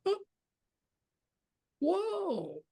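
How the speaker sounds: high, hushed gasp of wonder; awed whoa. Pretend. Inmate suddenly seeing his worth, hushed awe. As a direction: let the realization land softly.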